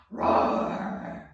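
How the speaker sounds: papa bear roar. Shown as a demonstration. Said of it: "Give me a big roar for Papa".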